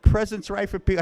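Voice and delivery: high, tearful lament; almost in tears. Retold or imitated. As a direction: imitate her on the verge of tears. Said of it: "she's almost in tears".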